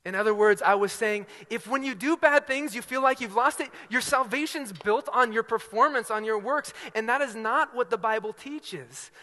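loud, high, emphatic pulpit delivery; impassioned preaching. Real, not acted. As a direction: drive the point home at full force.

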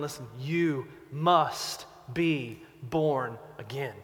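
slow, low and earnest; preacher urges the congregation slowly and earnestly; slow appeal. Real, not acted. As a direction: plead slowly with the room.

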